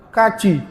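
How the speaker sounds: kaci with a hard k instead of h; Javanese kaci. Shown as a demonstration. Teacher imitates how Javanese say haji. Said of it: "kalau orang Jawa".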